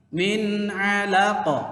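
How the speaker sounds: "Min alaqa" chanted slowly with clear n; correct recitation. Shown as a demonstration. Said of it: "Nah, gitu ya kan". ("Min alaqa" dilantunkan pelan, nun jelas)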